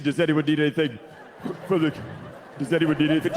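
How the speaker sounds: low voice forcing words out; holding in a burp. Retold or imitated. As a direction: talk past a rising burp. Suppressing burp.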